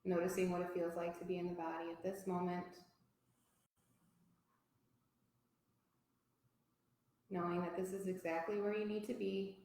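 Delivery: soft, unhurried yoga teacher voice with long pauses; guided relaxation. Real, not acted. Teacher guiding a yoga class to notice body and breath, calm guided relaxation. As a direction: settle them gently into stillness.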